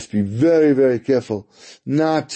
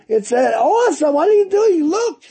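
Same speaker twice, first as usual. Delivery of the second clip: hammed-up hysterical mother; mother screaming. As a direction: wail at the ruined carpet. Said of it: "His mother shouts and, and screams".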